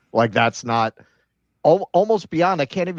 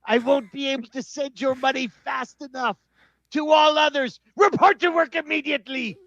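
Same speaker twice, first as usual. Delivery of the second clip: mock Trump voice; 14 semitones higher than usual. Podcaster reading out a Trump post, mocking bluster. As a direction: puff it up like a blustering president.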